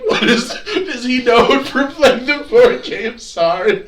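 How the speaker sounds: high, loud, laughing disbelief; laughing, confused. Retold or imitated. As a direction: ask it through laughter, baffled. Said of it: "I'm like".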